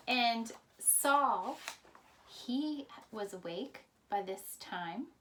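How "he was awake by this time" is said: ordinary delivery, nothing marked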